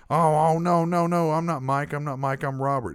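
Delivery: fluster, insisting on his own name; dopey coworker voice. Retold or imitated. Lightly voiced coworker protesting the nickname. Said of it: "voice for Robert sounded s".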